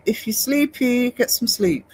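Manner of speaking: ordinary talk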